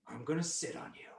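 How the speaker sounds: soft, understated menace; quiet threat. Acted. King looking rooster in the eye, quietly threatening. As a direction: say it softly as a threat.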